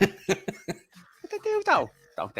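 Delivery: laughing through it. Acted. Echoes while laughing.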